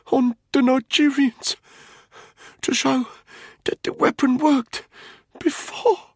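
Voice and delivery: high, strained, halting gasps; voice cracking badly. A narrator's reading. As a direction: struggle it out in short broken phrases. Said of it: "His voice was cracking badly".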